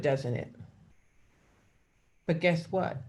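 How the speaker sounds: ordinary talk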